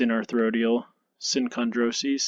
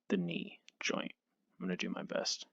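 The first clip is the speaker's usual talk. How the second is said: dramatic whisper; 7 semitones higher, 11 dB quieter than usual. Real, not acted. Nervous about drawing the knee.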